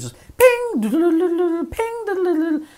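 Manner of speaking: high sung phone pings and buzzing; high mock ringtone; phone ping noises. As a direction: imitate the phone going off nonstop. Imitating phone notification sounds.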